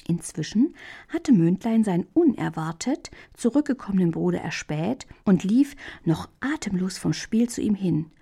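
ordinary delivery, nothing marked

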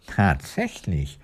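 soft, slow astonishment; astonished. A narrator's reading. Woodcutter learning he faces a queen, hushed astonishment. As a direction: let it out quietly, amazed. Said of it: "staunte der Holzfäller".

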